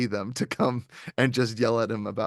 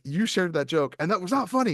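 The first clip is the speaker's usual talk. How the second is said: mock scolding; 7 semitones higher than usual. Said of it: "he's like".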